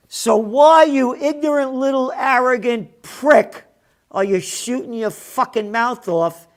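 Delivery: high, loud furious outburst; angry shout. Real, not acted. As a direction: shout the insult at him. Furious rant at Trudeau.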